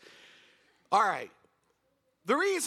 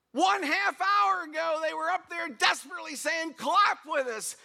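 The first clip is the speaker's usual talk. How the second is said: higher, louder, worked-up telling; indignant; 9 semitones higher, 4 dB louder than usual. Real, not acted. Preacher venting to the congregation, indignant. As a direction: let the outrage climb.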